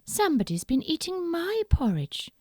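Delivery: a hint of a mother bear; lightly voiced mummy bear. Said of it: "said Mummy Bear".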